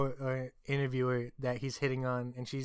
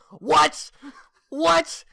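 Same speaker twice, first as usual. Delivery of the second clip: much higher, louder snapped what; mock angry what; 11 semitones higher, 9 dB louder than usual. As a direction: bark it back, offended. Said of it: "You make it sound like I was pissed off".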